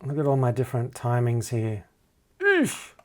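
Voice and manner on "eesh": sharp high-pitched eesh; a quick cringe at the mess; wincing eesh. Real, not acted. Cringing at all his uneven vocal timings.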